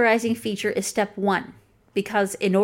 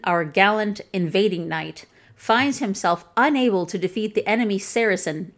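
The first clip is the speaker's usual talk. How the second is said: ordinary talk, nothing marked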